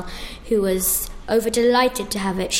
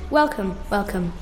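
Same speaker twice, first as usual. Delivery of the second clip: a lightly voiced kindly doorkeeper, a bit lower; friendly doorkeeper voice; 3 semitones lower than usual. A narrator's reading. Doorkeeper greeting the griffin at the golden door, lightly friendly. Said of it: "A friendly voice said".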